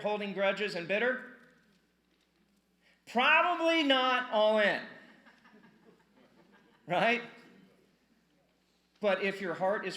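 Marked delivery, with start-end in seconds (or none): 3.1-7.2 loud, higher, slow punchline; loud verdict. Real, not acted. Pastor delivering the blunt answer, loud and slow.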